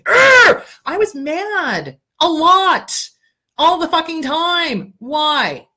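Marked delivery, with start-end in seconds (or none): 0.0-0.6 loud high teasing roar of rage; ragey teasing growl; mock angry roar. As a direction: roar like a cartoon hothead to mock her. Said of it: "John used to tease me".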